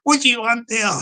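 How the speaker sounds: lightly voiced person behind the door; person inside challenging caller. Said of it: "inside's going like that".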